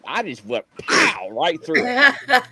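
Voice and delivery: amused recalling his crash; laughing. Real, not acted. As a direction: chuckle through the crash. Laughing about crashing through fence.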